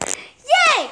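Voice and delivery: a high happy yay; high-pitched burst of joy; excited cheer. Acted. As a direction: cheer like a delighted kid. Lori finally agrees to go.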